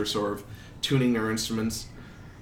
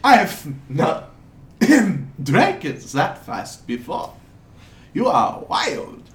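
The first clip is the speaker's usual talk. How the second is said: put-on slow, loud, muddled European accent; hammed-up unplaceable accent, drawn out; wandering foreign accent; 4 semitones higher, 5 dB louder than usual. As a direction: play an amazed foreigner who can't settle on an accent. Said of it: "his accent keeps jumping around a lot".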